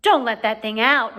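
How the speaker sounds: loud, sharp warning to keep the cat in; urgent warning. Acted. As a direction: Ron snaps a warning to Hermione, loud and urgent. Ron warns about the cat.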